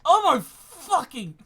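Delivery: usual speaking voice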